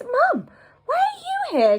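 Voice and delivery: put-on high, startled child's question; surprised girl. Red hugging her mother, high surprised girl. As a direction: ask it in happy surprise.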